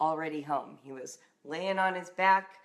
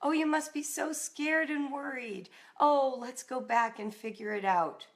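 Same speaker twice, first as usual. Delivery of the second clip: tender; 6 semitones higher than usual. Retold or imitated. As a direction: soothe a scared daughter gently. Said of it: "his reaction was".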